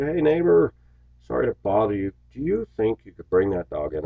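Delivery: friendly high greeting to the neighbor, then polite; polite request. Retold or imitated. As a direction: sweetly ask the neighbor a favor. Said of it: "I said".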